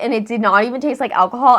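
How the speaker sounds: ordinary talk, nothing marked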